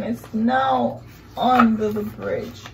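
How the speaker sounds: ordinary delivery, nothing marked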